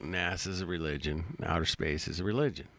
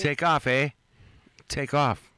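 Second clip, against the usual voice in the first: loud hammed-up Canadian "take off, eh"; Canadian accent; 7 semitones higher, 8 dB louder than usual. Streamer quoting Strange Brew in a loud Canadian accent. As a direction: bark it like a movie Canuck. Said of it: "Strange Brew".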